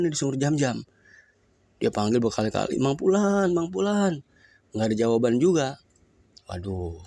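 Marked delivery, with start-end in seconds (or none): 2.8-4.2 high repeated call. Acted. Rich man calling down the silent well, high call. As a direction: call again, straining.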